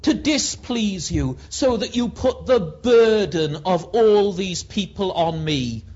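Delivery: loud, high, anguished protest to God; Moses crying out. Acted. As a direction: hurl the complaint at heaven. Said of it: "Listen to Moses".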